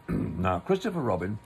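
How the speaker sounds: usual speaking voice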